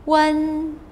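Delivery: "Wen" held high and level; one steady high note on "Wen"; first tone. Shown as a demonstration. Teacher running all four tones in sequence, "Wen" high and level. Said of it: "in all of the four tones in sequence".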